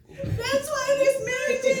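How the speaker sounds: high, loud Kittitian granny impression; mock-scold like her grandmother; imitating Kittitian grandmother. Husband mimics grandmother mid-argument, high Kittitian scolding. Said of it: "starts acting like my grandmother".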